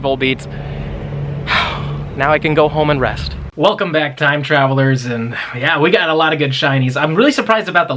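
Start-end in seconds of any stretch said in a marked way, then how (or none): 0.5-2.1 long, voiceless exhale of relief; relieved sigh. Real, not acted. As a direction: breathe out after a long wait. Sigh after long hunt ends.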